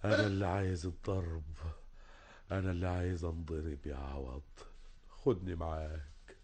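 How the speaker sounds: soft, low, defeated begging; barely above a breath, defeated; soft, low, exhausted plea; broken plea. Acted. Broken man asks to be taken.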